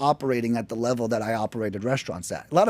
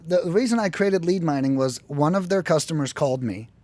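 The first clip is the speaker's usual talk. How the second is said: ordinary delivery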